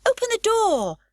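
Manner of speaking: put-on high, loud child calling through the door; crying out. A narrator's reading. Annika calling to Pippi through the door, high and loud. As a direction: call out so she'll open up. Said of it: "cried Annika".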